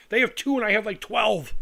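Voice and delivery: normal talking voice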